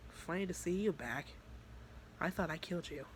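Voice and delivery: soft, cool menace; threatening. Retold or imitated. Monica facing the demon Vice, quietly threatening. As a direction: greet the old enemy quietly, lightly threatening. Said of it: "they just literally were just threatening each other".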